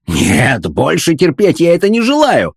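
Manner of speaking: explode, patience finally gone; enraged yell. A narrator's reading. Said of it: "заорал фон Хартман, окончательно рассвирепев".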